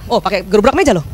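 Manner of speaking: usual speaking voice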